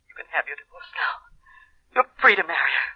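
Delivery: low, hushed surrender; hurt resignation. Acted. Woman releasing her husband to marry another, sadly resigned. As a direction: let him go, voice dropped and subdued. She gives him up, hurt.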